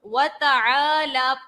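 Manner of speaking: slow وَتَعَالَى with the لا drawn long; stretched lam. Shown as a demonstration. Teacher asking students to listen, stretching the لام. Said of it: "دیکھیں پڑھ کیا رہی ہوں".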